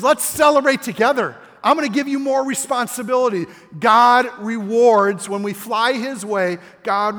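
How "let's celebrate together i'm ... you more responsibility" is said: high, loud, jubilant quote; master's celebration. Retold or imitated. Master rewarding his servant, high and jubilant. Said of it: "He says".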